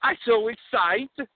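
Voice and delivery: put-on eager Borat, Kazakh accent. Borat asks the hosts about ladies, Kazakh accent. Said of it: "my name is, uh, Borat".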